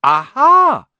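high, loud triumphant aha. A narrator's reading. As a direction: pounce on it. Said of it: "said Harry".

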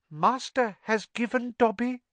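high squeaky elf voice, awed; elf in wonderment. Acted. House elf marvelling at the sock from his master: high and awed. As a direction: marvel at the gift in a tiny high voice. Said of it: "said the elf in wonderment".

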